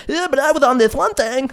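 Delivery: imitating the guy who cut in; a mocking whiny friend voice; mock interrupter.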